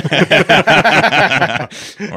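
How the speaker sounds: high, loud laughing outburst; big startled laugh; loud burst of laughter. Real, not acted. Laughing at the whiskey offer.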